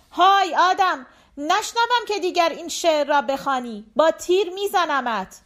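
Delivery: threatening shout. A narrator's reading. Turkmen threatens the departing rider, threatening shout. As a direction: shout a threat after someone. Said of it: "ترکمن فریاد کشید".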